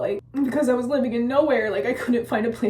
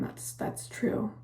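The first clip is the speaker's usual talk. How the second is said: whispered aside; 7 dB quieter than usual. Real, not acted. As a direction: drop to a whisper to agree. Aside agreeing with herself.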